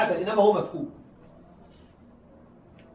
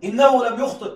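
example called out; 5 semitones higher, 4 dB louder than usual. Shown as a demonstration. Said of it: "تقول لفلان".

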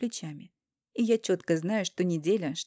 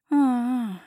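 louder hurt, drawn-out groan; disappointed drawn-out ah; 5 semitones higher, 5 dB louder than usual. Acted. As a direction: drag it out in disappointment. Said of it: "Разочарованно, обиженно тяну".

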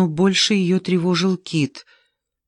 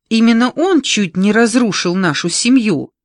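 louder, higher heated accusation; unrelenting; 5 semitones higher, 7 dB louder than usual. A narrator's reading. Olga accusing her brother of nearly wrecking the family, worked up. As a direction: press the charge, refusing to calm down. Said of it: "не успокаивалась Ольга".

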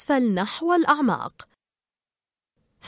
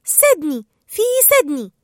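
loud cry, climbing higher; shouting; 4 semitones higher, 5 dB louder than usual. A narrator's reading. Dory cries out the city name, excited shout. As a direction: call it out, higher the second time. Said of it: "صاحت دوري قائلة".

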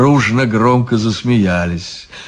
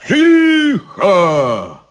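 barked; 9 semitones higher than usual. Acted. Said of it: "рявкнули все три головы".

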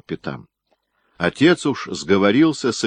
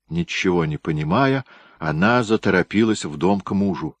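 ordinary delivery, nothing marked

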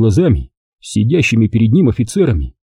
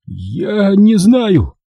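slow, higher, weeping plea; tearful cry; 9 semitones higher, much slower than usual. A narrator's reading. Captive weeping under interrogation. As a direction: beg through tears. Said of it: "По щекам чеченца текли слёзы".

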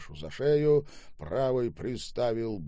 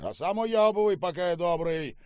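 loud, raised-pitch bullying order; rude dismissal; 10 semitones higher, 6 dB louder than usual. Acted. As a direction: send the servant packing. Штаубе rudely sends servant away. (грубо, с угрозой прогоняя)

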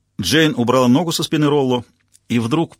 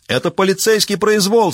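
much higher, louder yell; 9 semitones higher, 4 dB louder than usual. A narrator's reading. Rollo yelling as he is hauled up, loud. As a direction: yell the outrage at the court. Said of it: "завопил Ролло".

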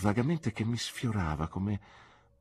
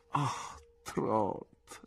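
sad sigh; 3 semitones higher, 4 dB quieter than usual. Acted. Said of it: "con un triste sorriso".

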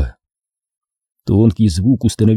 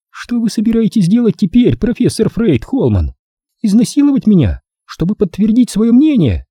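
high mocking female voice, much higher; woman's voice; 11 semitones higher than usual. Narrator voicing angry Corola taunting him. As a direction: play the woman sneering back at him.

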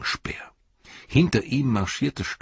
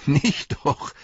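laughing; 10 semitones higher, 4 dB louder than usual. A narrator's reading. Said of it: "sagte Pippin und lachte".

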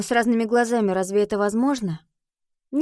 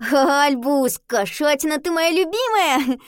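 delighted high call; excited; 6 semitones higher, 4 dB louder, slower than usual. Acted. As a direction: gush over the cat. Girl greets beloved cat.

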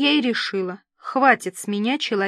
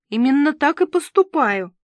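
high, louder plaintive protest; nearly crying; 6 semitones higher, 5 dB louder than usual. A narrator's reading. Host defends herself to the assistant, nearly in tears. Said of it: "чуть не плача ответила я".

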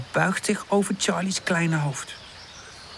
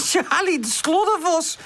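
high giggle running into the words; high-pitched, tickled storybook voice; laughing; 12 semitones higher than usual. A narrator's reading. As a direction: burst out laughing, then tease. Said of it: "lacht Woezel".

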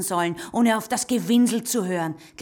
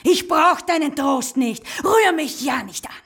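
loud, slow, cutting refusal; furious don't-touch-me; angry rejection; 7 semitones higher, 7 dB louder than usual. Acted. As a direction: push her away with force. Rejects comfort angrily.

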